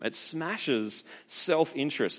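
ordinary talk, nothing marked

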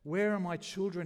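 loud, high, desperate plea; pleaded. A narrator's reading. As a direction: beg loudly into the dark. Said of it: "he pleaded".

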